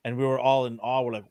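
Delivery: ordinary talk, nothing marked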